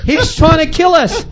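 mock panic. Witch doctors running for their lives, mock panic. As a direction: panic climbing to a very high cry. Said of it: "running for their life".

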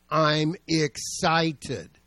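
loud, very drawn-out declaration of excitement; excited proclamation. Acted. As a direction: proclaim it slowly, stretching every word. Acting out eagerness to meet Jesus.

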